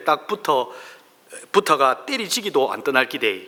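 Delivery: Psalm read in broad Gyeongsang dialect, lightly performed. Acted. Pastor reads Psalm 23 to his congregation in Gyeongsang dialect. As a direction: recite it like a Gyeongsang native, folksy and blunt. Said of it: "경상도 버전으로 말씀을 보겠습니다".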